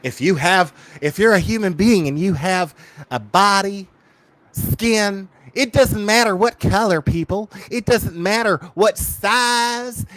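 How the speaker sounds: sarcastic over-the-top parody of a self-righteous host; mocking Bullhorn Betty. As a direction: ham up a gushing YouTuber preaching acceptance. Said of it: "now she's an advocate for everybody".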